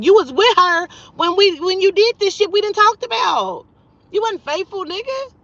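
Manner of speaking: high, loud accusing outburst; heated callout. Real, not acted. Calling out a lying friend, high loud anger. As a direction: call him out hard on his lie. Calling out his faithfulness claim.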